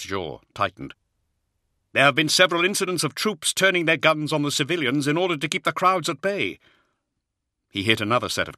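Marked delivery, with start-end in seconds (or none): 1.9-6.6 much higher, strained colonel voice; a harried Jaeger officer reporting under pressure; Hadziel, harried officer. Acted. Officer's voice held by narrator.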